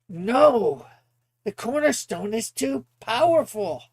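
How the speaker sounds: high-pitched anguished villain's refusal; acted desperate protest; defiant refusal. Opponent refuses Flame's plea, acted.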